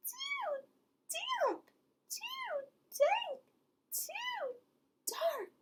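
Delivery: very high, thin little mouse voice; tiny squeak. Acted. Mouse complains about the cave in a high squeak. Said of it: "Mouse squeaks".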